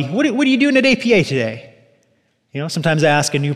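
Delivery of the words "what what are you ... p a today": high, quick chatty question; casual lobby greeting. Acted. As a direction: toss it out like lobby small talk. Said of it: "I might ask you in the lobby".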